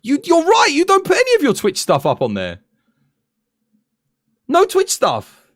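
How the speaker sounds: high and loud, mock outrage; excited high voice. Real, not acted. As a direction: exclaim it up high.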